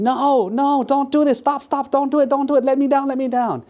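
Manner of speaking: high-pitched, rapid frantic pleas; panicked screaming. Acted. Woman lifted over the boat's edge, screaming in panic. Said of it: "She started screaming".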